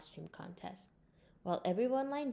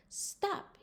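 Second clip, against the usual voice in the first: shouted stop. Acted. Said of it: "he shouted".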